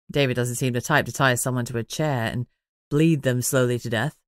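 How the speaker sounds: lightly voiced teasing man; Manners, teasing. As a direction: tease her, pitched low and easy.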